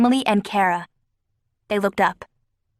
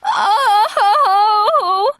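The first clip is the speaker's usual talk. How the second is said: high loud wobbly oh; wobbly cry; 12 semitones higher, 6 dB louder than usual. A narrator's reading. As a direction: cry out shakily. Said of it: "Ozzy's voice sounded wobbly".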